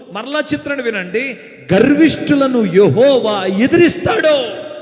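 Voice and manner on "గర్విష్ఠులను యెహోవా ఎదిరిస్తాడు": loud, slow thundering verse; proclaiming scripture. Real, not acted. Preacher thunders a verse against pride to the church, loud and slow.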